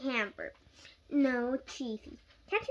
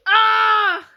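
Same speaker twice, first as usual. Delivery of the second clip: loud high scream; 9 semitones higher, 18 dB louder than usual. A narrator's reading. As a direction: shriek in panic. Mom screams again offstage.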